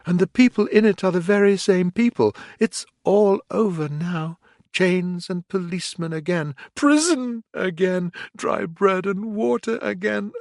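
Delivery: panicked. A narrator's reading. Toad's panic.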